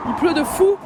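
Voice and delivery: excited exclaim. Real, not acted. Reacting to pouring rain.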